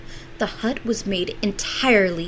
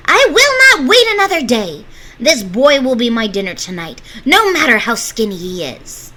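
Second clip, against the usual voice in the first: loud, high furious yell; yelling witch; 6 semitones higher, 13 dB louder than usual. Acted. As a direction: yell it in a rage. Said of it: "One day the witch yelled".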